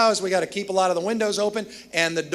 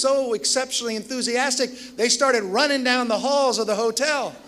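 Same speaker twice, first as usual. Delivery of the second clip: higher, worked-up rush of disbelief; the engineer reporting the raccoon mess to his boss, higher and excited; excited retelling; 6 semitones higher than usual. Retold or imitated. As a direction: tell it like the wildest thing you've ever seen.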